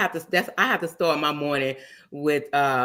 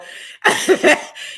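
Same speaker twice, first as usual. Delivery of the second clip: breathy laugh; 13 semitones higher, 7 dB louder than usual. Real, not acted. Laughing at breaking stuff.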